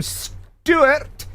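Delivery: "Stuart" drawn out slowly, trying it the Scottish way; attempted Scottish pronunciation. Shown as a demonstration. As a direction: have a careful go at a Scottish "Stuart". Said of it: "Did I get it?".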